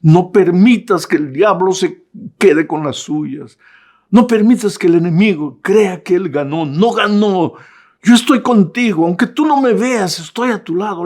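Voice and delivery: rally them with rising force; rousing exhortation. Acted. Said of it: "lo que Pablo te dice hoy".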